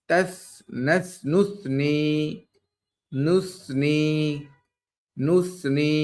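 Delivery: each word drawn out very slowly for the class; slow Quran teacher demo; slow careful recitation. Shown as a demonstration. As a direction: recite the examples slowly, syllable by syllable. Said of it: "নিচে আমরা কিছু example দিয়েছি".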